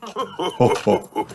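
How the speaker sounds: rolling "ho ho" laugh; round, jolly character laugh; jolly chuckle. A narrator's reading. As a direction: chuckle heartily as a cartoon daddy. Daddy Pig laughs during the tour.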